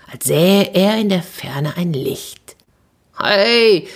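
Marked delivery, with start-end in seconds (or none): 3.2-3.9 lightly raised call to friends; mild storyteller's shout. Acted. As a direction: call over to the others. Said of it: "rief er den anderen zu".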